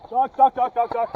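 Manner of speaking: urgent repeated shout. Real, not acted. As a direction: yell the warning fast. Warning teammates under fire.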